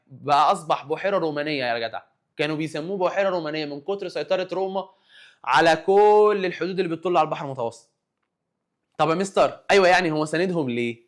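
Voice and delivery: normal talking voice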